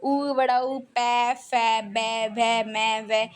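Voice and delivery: lip letters "पै, फै, बै" with lips meeting; sounding out letters. Shown as a demonstration. Teacher shows lip letters, asking students to watch lips. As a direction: press the lips on each sound. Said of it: "होंठ को देखो".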